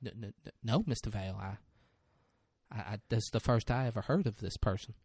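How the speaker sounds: low, softly stammering witness; halting witness. Acted. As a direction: stumble gently over the opening words. Retelling a timid witness's halting testimony.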